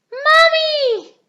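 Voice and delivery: high, loud alarmed call; crying out. A narrator's reading. Peppa cries out for Mummy as the wind takes the food. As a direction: call for help as food flies. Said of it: "cried Peppa".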